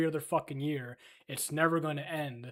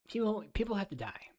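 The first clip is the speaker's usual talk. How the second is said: higher mock pundit voice; Ben Shapiro impression; 6 semitones higher, 3 dB quieter than usual. Streamer mocks Ben Shapiro again, higher pitched. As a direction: parody him pitched up. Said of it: "That's my Ben Shapiro voice".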